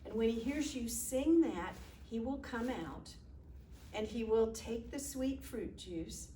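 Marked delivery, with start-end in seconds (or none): none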